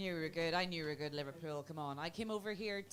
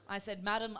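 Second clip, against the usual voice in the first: normal talking voice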